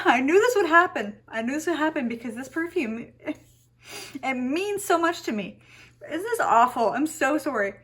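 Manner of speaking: high, tearful wail of real emotion; crying. Real, not acted. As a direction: push the words out through tears. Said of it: "I'm gonna cry".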